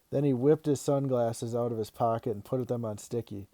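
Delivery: plain reading voice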